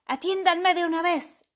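high, loud indignant crow; exclaiming. A narrator's reading. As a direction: demand attention sharply. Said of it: "exclamó".

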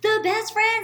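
high, loud, sugary gushing; overjoyed bestie laying it on thick; gushing best friend. Retold or imitated. Best friend gushing at the narrator, high and loud. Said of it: "And she's just like".

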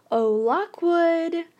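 high, slow, falsely breezy call; forced lightness. A narrator's reading. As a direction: call him as if nothing were wrong. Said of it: "I said it as lightly as I could".

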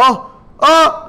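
high, loud imitated crowd gasps; two startled mock yelps of "oh"; shocked cries. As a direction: audience reacting in sudden alarm. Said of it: "screaming bloody murder".

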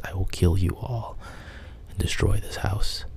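usual speaking voice

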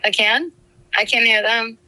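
usual speaking voice

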